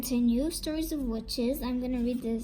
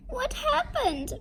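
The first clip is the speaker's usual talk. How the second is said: very high-pitched worried question; alarmed cry; 13 semitones higher than usual. A narrator's reading. Mother sees her crawling daughter, high alarmed cry. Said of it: "cried her mother".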